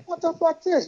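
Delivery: high, indignant mock disbelief; baffled onlookers. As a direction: voice the crowd's outrage. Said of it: "everybody else is like".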